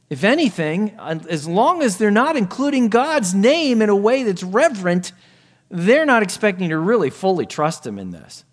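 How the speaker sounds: fervent pulpit delivery; full-force preaching. Acted. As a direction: drive it home to the believers. Preacher raises voice.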